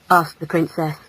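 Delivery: ordinary delivery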